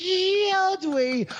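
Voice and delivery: high questioning "Should we?"; quoting himself. Retold or imitated. Hammed-up self quote. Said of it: "I was gonna say".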